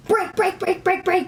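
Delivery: high, loud panicked yelling; backseat driver. Retold or imitated. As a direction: shriek it like a panicking driving teacher. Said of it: "I'm gonna freak out".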